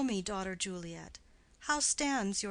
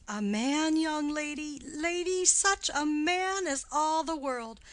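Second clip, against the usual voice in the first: excited gushing; 6 semitones higher, 5 dB louder than usual. Acted. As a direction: swoon over the suitor. Nurse gushing about Paris.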